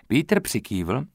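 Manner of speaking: ordinary delivery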